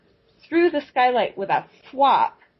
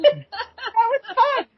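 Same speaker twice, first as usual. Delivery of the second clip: much higher, fast, delighted cat; happy cat voice; 14 semitones higher, 4 dB louder, much slower than usual. Retold or imitated. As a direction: squeak it out like a pleased kitty. Voicing the happy purring cat.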